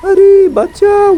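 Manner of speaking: very high, slow, squealed help; squeaky mock cry for help. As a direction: shriek for help like a cartoon victim. Car chaos, cries for help. (খুব উঁচু টানা চিৎকারে বাঁচাও)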